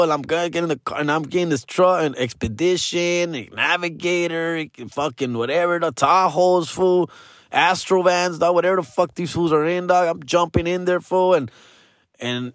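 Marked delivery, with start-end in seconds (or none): none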